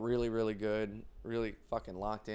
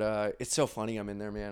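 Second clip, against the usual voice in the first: usual speaking voice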